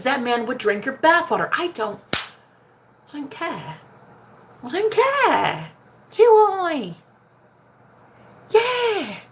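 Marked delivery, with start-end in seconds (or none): 1.5-9.2 sassy mock.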